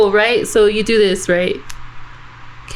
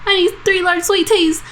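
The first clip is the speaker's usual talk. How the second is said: imitating customer; 9 semitones higher than usual. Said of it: "it was this guy".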